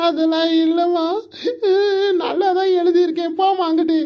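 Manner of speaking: high, sing-song crying; whiny sobbing son, hammed-up; wailing. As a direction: bawl the words out between sobs. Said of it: "அப்ப எதுக்கு தான் அழுற".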